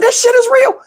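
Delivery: high-pitched comic shock; acted-out amazement; astonished reaction. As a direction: exclaim it way up high in disbelief. Acts shock that spells exist.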